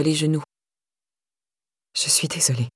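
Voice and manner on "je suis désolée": soft, low murmur; murmured apology. A narrator's reading. Carla, slumped over spilt tea, murmuring an apology. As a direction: apologise quietly, voice dropped. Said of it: "murmura-t-elle".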